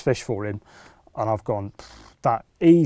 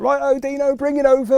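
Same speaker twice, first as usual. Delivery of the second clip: much higher, calling across the bank; calling out eagerly; 14 semitones higher, 4 dB louder than usual. Retold or imitated. Mate calling to Dino for his record fish, high eager call. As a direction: shout for the fish to be brought over. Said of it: "he was like".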